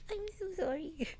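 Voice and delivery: tiny, high, soft sorry; sheepish apology. Real, not acted. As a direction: squeak out an embarrassed apology. Embarrassed apology for botched French.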